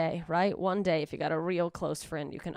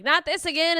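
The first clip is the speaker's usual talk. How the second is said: raised, higher exasperated yell; mock yell at the sky; 8 semitones higher, 7 dB louder than usual. Waking in quarantine, yelling at the sky, exasperated. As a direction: shout it up at the heavens.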